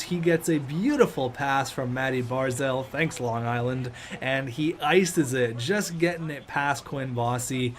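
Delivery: ordinary talk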